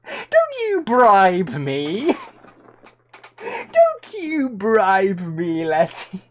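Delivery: slow, drawn-out mock warning; mock scolding. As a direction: stretch it out, playfully stern. Scolding chat's bribe, drawn out.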